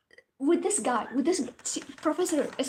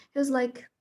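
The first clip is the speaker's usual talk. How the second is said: ordinary talk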